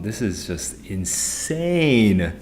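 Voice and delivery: slow, stretched-out exasperation; slowly dragging out insane, fed up; drawn-out disbelief. Real, not acted. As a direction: drag out every word in weary disbelief. Exasperated over technical problems.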